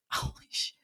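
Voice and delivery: hushed, whispered swearing; stunned whisper. Retold or imitated. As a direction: whisper it as if floored by the news. Retelling stunned reaction to news.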